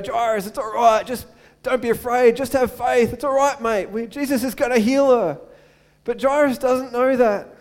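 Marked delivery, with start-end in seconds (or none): 0.0-5.4 louder, higher, cheering him on; hearty encouragement. Acted. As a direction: call out to him like a mate. Congregation calling out to Jairus.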